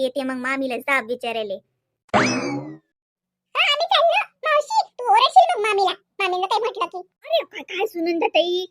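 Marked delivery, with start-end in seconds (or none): 2.1-8.7 put-on high, loud excited kid voice; a giggling child shouting to auntie and running to hide; playful child. Children to their aunt, a squealing excited kid.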